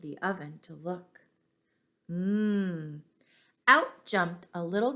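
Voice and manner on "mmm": appreciative hum of tasting; savoring mmm. Acted. Old woman smelling the gingerbread, savoring hum. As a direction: hum like you smell fresh baking.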